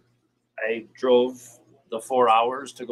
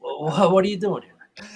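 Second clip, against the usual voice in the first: higher, louder incredulous question; mock disbelief; 6 semitones higher, 4 dB louder than usual. Retelling seeing rival fans nearby, hammed-up incredulous question. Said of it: "I'm just thinking to myself".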